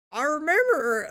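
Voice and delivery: high, loud beetle voice. Acted. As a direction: squeak it like a beetle. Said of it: "my fucking Beetle voice".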